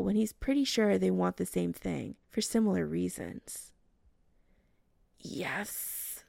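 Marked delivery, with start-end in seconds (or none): 5.2-6.2 whispered, breathy moan. Acted. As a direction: sigh the word out as a moan. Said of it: "Chris moans again".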